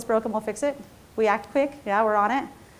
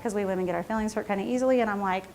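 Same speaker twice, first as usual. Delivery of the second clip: ordinary talk, nothing marked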